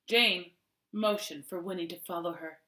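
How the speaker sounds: ordinary delivery, nothing marked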